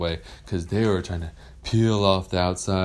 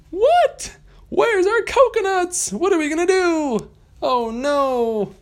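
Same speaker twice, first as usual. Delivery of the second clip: put-on very high, louder squeaky panicked kids; shocked Lost Boys; 6 dB louder, slower than usual. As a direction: squeal in alarm at the missing coconuts. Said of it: "they said".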